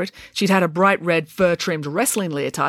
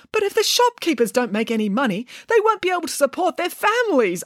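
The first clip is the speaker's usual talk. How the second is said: high-pitched, anxious protest; worried manager's objection; arguing; 11 semitones higher than usual. A narrator's reading. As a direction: argue it, climbing higher as you plead. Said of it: "argued the manager".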